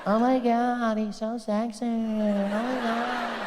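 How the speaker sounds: high, breathless mock swoon; swooning woman. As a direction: gush like a smitten fan. Swooning woman at French accent.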